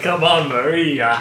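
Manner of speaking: slow, loud mock foreign accent; drawn-out attempt at a Soviet accent; unplaceable accent. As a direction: imitate a badly placed foreign accent. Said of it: "Where is that?".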